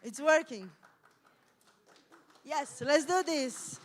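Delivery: loud relieved celebration; triumphant, slow it's working; excited. Real, not acted. Presentation finally working.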